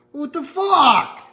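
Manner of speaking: sudden high, loud and slow disbelief; shocked outburst. Real, not acted. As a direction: blurt it out, stunned. Sees the panels are misaligned.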